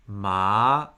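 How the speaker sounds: ma rising from low upward; second tone. Shown as a demonstration. Teacher gives ma the second tone, rising. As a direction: lift ma like a question. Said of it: "give it the four tones".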